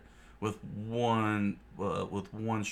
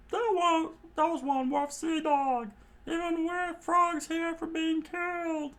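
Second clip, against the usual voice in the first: much higher, cautioning; high warning voice; 4 dB louder than usual. Acted. Johnny and Yosaku warning Nami about a pirate, high. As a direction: warn her off that pirate.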